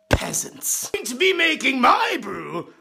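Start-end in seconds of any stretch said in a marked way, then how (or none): 0.1-0.9 whispered sneer. Acted. Sneering at the poor viewers.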